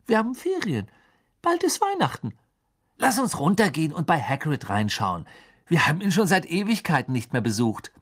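put-on high, bright-eyed boyish enthusiasm; eager subject change. A narrator's reading. Ron suggests visiting Hagrid to change the subject, eager. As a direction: propose it eagerly, pitch lifted. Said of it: "auf der Suche nach einem anderen Thema".

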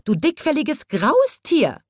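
high-pitched cross insult; indignant scolding. A narrator's reading. Giraffe insulting the elephant, high and indignant. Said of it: "schimpfte die Giraffe".

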